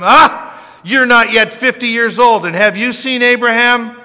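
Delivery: loud, high, scoffing disbelief; scornful mockery. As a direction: jeer at him as if he's absurd.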